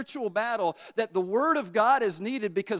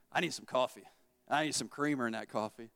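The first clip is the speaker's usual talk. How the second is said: lower, softer, longing voice; craving murmur; 9 semitones lower, 7 dB quieter than usual. Retold or imitated. The body begging for creamer, soft and low. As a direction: pine for the coffee quietly.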